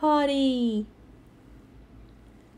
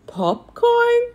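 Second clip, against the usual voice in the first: surprised; 9 semitones higher, 5 dB louder than usual. Acted. Gang puzzled by new topping.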